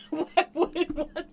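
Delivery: laughing, high, slow mock posh; imitating posh Brit. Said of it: "posh impression".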